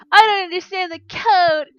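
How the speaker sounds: put-on high, loud, whining kid; whiny student voice. Student quoted as not getting it.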